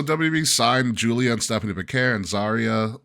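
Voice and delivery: ordinary talk, nothing marked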